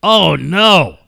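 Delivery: loud, drawn-out dismay; dismayed reaction. Real, not acted. Cohost dreading where the story goes, loud dismay. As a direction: groan it out loudly in sympathy.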